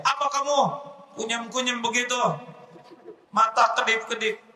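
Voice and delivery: high, sharp scolding; boss scolding. Acted. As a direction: nag him like an angry boss.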